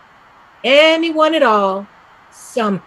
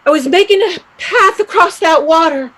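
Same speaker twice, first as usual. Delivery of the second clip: fast, louder and higher rush; excited; 5 semitones higher, 6 dB louder, faster than usual. Acted. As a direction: rush out the vision, thrilled. Excited about her vision.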